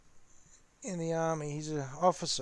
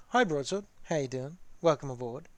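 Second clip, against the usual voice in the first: usual speaking voice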